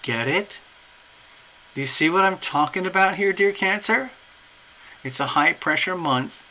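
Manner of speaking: ordinary delivery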